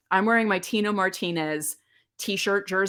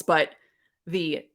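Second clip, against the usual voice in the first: ordinary delivery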